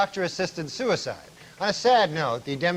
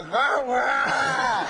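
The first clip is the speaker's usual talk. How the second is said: imitating Larry Flint; 8 semitones higher than usual. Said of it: "he said to me".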